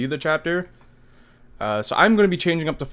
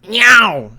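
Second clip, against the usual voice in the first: yowling meow; 7 semitones higher, 9 dB louder than usual. Retold or imitated. Frankie's poster joke.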